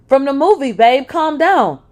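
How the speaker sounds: high, loud and slow pleading; desperate pleading. Acted. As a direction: beg him to calm down. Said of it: "trying desperately to calm him down".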